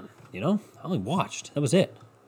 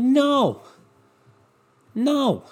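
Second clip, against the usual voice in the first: angry refusal; 12 semitones higher than usual. Retold or imitated. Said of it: "I'm so angry".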